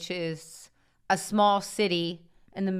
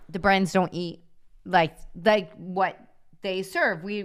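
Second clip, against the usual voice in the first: ordinary talk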